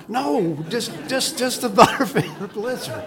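high-pitched, joking insistence; amused, laughing through the words; laughing protest. Real, not acted. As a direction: break into a laugh while insisting.

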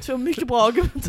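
mock grand proclamation. Real, not acted. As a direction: boom it out like a pompous verdict. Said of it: "repeated as punchline".

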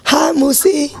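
hoarse voice from a cold. Real, not acted. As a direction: speak through a sore throat. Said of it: "my voice sounds disgusting".

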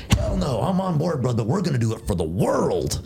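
high-pitched gung-ho outburst. Retold or imitated. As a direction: fire it off high, all in for the mission. Retold gung-ho reply by the other man.

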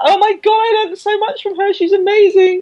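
high, gushing admiration in a mock voice; a hint of a starstruck athlete gushing; gushing Chloe. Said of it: "Chloe was saying".

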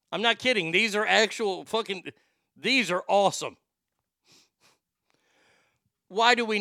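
loud, high incredulous rant; rant, building disbelief; worked-up rant. Real, not acted.

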